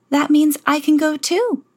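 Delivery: put-on high, eager young voice; light girlish hope; hopeful Cinderella. A narrator's reading. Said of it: "Cinderella said".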